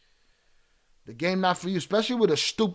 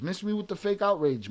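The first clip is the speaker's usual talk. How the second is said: ordinary talk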